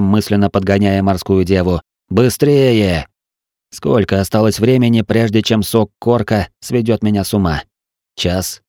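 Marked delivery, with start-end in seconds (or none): none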